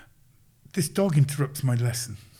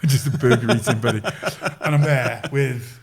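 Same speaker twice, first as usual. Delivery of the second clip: louder, laughing through the words; amused self-mockery breaking into laughter; 6 dB louder than usual. Real, not acted. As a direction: crack up at your own joke as you say it. Laughing at his own self-deprecating joke.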